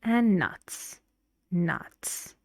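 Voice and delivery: "nuts" said with a falling tune; teacher models the final list item, falling. Shown as a demonstration. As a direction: let "nuts" drop to close the list. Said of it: "going down".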